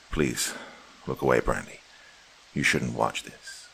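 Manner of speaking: tender plea. Acted. Husband tenderly telling wife not to watch.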